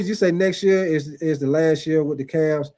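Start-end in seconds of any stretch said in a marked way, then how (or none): none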